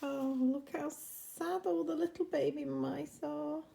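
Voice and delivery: usual speaking voice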